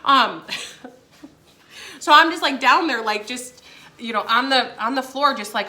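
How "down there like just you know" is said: ordinary talk, nothing marked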